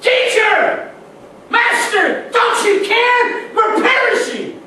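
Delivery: high, loud desperate shout over the storm; frantic disciples shouting at their teacher; panicked cry for help. Acted. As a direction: scream to be heard above the waves.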